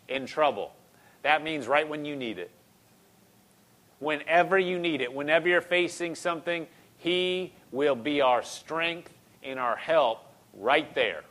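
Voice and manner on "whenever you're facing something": ordinary talk, nothing marked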